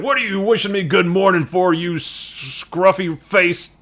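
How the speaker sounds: mock-angry growl at a friendly greeting; hammed-up cranky commander; Nick Fury. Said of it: "gruff and aggressive".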